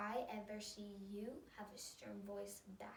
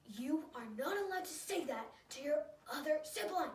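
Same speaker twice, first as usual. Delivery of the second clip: loud, higher scolding, heavily emphatic; stern voice; 6 semitones higher, 9 dB louder than usual. Shown as a demonstration. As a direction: lay down the law in a demonstrated yell. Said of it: "give a stern voice like this".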